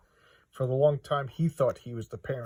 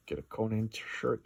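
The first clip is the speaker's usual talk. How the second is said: normal talking voice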